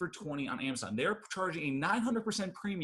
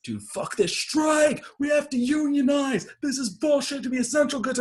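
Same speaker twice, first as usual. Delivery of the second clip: furious rant; 14 semitones higher, 9 dB louder than usual. Retold or imitated. Amazon worker fed up with his shift, shouting furiously. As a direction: rage at the unfair shift. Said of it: "he's just like".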